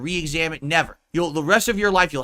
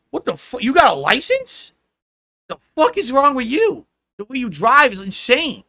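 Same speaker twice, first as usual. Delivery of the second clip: higher, louder disbelieving rant; incredulous outburst; 7 semitones higher, 5 dB louder than usual. Retold or imitated. Passenger scolding a friend's wild driving, incredulous yell. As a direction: tear into a reckless driver in disbelief. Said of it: "I'm like".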